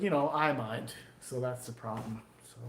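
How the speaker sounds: ordinary talk, nothing marked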